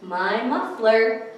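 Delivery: slow, bright read-aloud rhyme; reading to children. Acted. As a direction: read the rhyme slowly and warmly. Reading picture book aloud.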